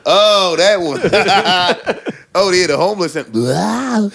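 laughing homeless groan; cracked rambling vagrant. Shown as a demonstration. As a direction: moan like a wrecked drifter, laughing. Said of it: "Just do the sound".

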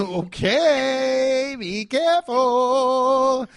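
high, drawn-out caution; sing-song warning. Real, not acted. Co-host warning about flippant accents, sing-song warning. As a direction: stretch it out high as a playful warning.

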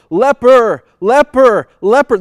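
loud, high calls of leper, leper; calling out a warning. Retold or imitated. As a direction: shout it out so people keep away. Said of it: "they would have to call".

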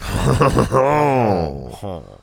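loud "hon hon" chortle; high exaggerated French laugh; mock French laugh. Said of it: "make that noise".

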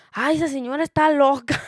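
high, loud put-on voice of another woman; high loud mock bystander; gossiping bystander lady. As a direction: imitate the neighbor lady saying she's crazy.

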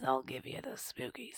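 soft eerie whisper; spooky whisper. Pretend. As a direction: whisper it like a ghost story. Spooky story intro.

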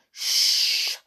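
sharp breathy shush; hissed shush. A narrator's reading. Amber silencing George in the lift, hissed shush. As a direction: hush the others urgently. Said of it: "hissed Amber".

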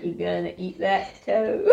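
slow laughing Southern drawl. Pretend. Friend reading Scout line in Southern drawl, laughing. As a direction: drag out the vowels, giggling. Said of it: "let's get the southern drawl on".

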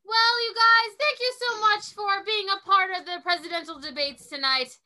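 hammed-up sign-off. Moderator closing a mock presidential debate, loud hammed-up sign-off. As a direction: project a big closing thank-you to the audience.

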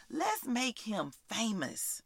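soft, slow savouring of the line; reflective repeat. Acted. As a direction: say it quietly and slowly, letting it sink in.

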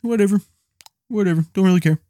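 high mock-bored voice; mock their shrug at the exhibit; bored family member. Wife and kid unimpressed by the museum, mock-bored high voice. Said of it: "the kid was kinda like".

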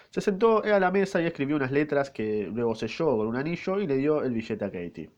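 ordinary talk

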